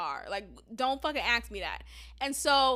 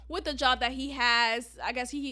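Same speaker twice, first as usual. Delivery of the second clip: ordinary delivery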